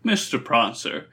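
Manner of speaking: ordinary delivery, nothing marked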